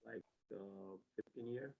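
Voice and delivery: barely audible mumble; too quiet. Real, not acted. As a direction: answer quietly, almost inaudible. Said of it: "You gotta talk louder".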